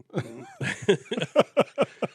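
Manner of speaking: imitating a Seth Rogen laugh. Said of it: "This is just a laugh impression".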